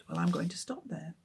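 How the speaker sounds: usual speaking voice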